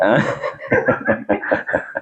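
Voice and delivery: soft, voiceless chuckle; laughter. Real, not acted. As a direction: laugh quietly, mostly on breath. Laughs at patient's puzzled question.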